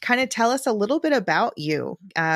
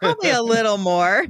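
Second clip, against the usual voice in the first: laughing through the words, then a high laugh; 6 semitones higher than usual. Real, not acted. Host teasing grandmother about her story, laughing. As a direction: chuckle out the line and let it break into laughter.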